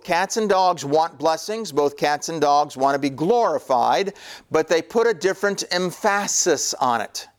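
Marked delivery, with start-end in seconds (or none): 5.7-6.7 emphasis with the stress on PHA; playful wrong stress in emphasis, on the second syllable; joke mispronunciation. Shown as a demonstration. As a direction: say emphasis as em-FASS-is. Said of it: "trying to play a little joke".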